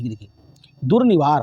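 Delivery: ordinary talk, nothing marked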